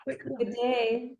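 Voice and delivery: a hint of Australian twang; Australian accent. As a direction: toss off a mock Aussie hello. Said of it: "I'm becoming more Australian".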